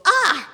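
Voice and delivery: sharp cry of pain from a stab; sudden high pained shout. Acted. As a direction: yelp as the knife goes in.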